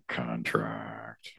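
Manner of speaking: contract, voiceless and hushed, repeated for effect; murmured aside repeating contract; whispered echo. Shown as a demonstration. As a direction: breathe the word contract as a whisper. Echoes the line as a whisper.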